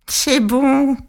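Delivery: lightly voiced witch, sneering; the witch gloating over her prey; mocking witch. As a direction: gloat like a patient old witch.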